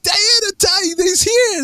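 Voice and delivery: high, stretched-out call to friends; acted-out thrilled shout, drawn out at the end; excited calling out. Retold calling friends over in dream.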